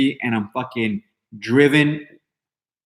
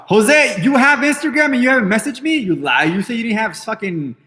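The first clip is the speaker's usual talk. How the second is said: indignant disbelief; 9 semitones higher than usual. Real, not acted. Streamer catching Jose out on Instagram, indignant and high-pitched. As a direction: call him out, voice shooting way up. Confronts Jose about not messaging him.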